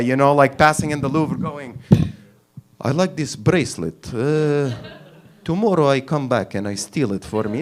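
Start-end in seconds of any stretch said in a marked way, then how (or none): 2.8-7.6 stolid Russian accent, imitating a huge thug; mock Russian accent, ponderous and deadpan; Russian heavyweight. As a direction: play a hulking Russian, unexcited. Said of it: "a guy called Igor, six hundred pounds from Russia".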